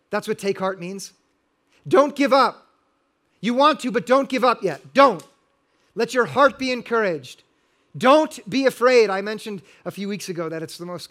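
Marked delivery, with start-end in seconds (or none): 1.9-9.1 louder pleading, don't give up; rising urgent plea to a frightened congregation; urgent exhortation. Real, not acted. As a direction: press hard on every don't, urging. Pastor urging the congregation.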